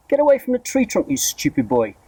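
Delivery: put-on high shrill yell at the boy; angry yell. A narrator's reading. Aunt yells at the boy by the trunk, angry. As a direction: bark at him to get away. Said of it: "Aunt Spiker yelled".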